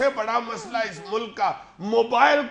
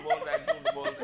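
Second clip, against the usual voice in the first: squeaky high voice, far higher than his own; mock phone voice; faster than usual. Phone tattling to dad in a squeaky high voice. Phone imitated calling out a lie.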